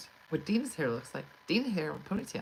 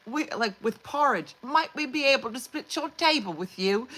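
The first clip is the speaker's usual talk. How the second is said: higher, louder begging orphan voice; pleading orphan; 8 semitones higher, 6 dB louder than usual. Retold or imitated. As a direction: ham it up like a pitiful little orphan. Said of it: "they're like orphans in".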